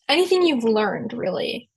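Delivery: normal talking voice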